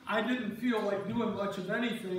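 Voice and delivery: ordinary delivery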